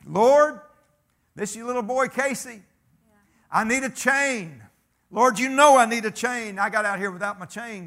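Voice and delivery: loud, high call up to God; imitating a man hollering a prayer; shouted prayer. Acting out Casey's roadside prayer.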